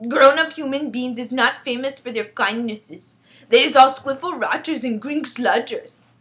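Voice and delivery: loud, emphatic giant voice; disapproving friendly giant. Acted. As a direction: grumble about humans as a giant, forcefully. BFG disparages humans.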